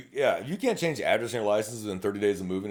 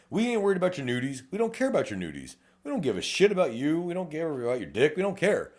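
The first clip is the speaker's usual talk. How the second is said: emphatic rant; 7 semitones higher than usual. Real, not acted. Detective telling applicants what matters, heated and high. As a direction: pitch up, heated insisting. Ranting about not caring.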